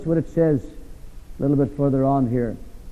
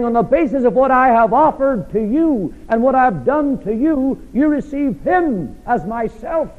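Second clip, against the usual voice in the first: full-force preaching; 10 semitones higher than usual. Acted. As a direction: press it home from the pulpit.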